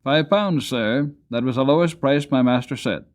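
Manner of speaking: name the price plainly; horse dealer. Acted. Seller answers.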